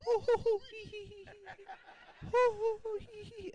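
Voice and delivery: high hooting owl call, breathy hee sounds; hammed-up owl hoot imitation; imitated owl hoots. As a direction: mimic a night owl hooting in the distance. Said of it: "all we could hear is".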